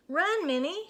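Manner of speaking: high, loud urgent shout. A narrator's reading. Moo shouting at Minnie to run. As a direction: call out in alarm. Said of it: "shouted Moo".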